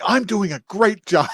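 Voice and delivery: retold gleeful pride, voice lifted; proud delight. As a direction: imitate someone sweetly pleased with themselves. Voicing proud character after scare.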